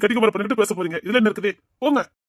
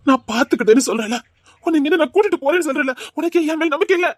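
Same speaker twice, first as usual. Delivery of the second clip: ordinary delivery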